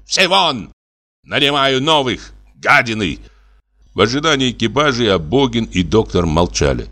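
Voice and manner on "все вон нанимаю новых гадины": high-pitched angry outburst; furious dismissal. A narrator's reading. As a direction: throw every servant out in a rage. Enraged master sacking all servants. (яростно, высоким голосом)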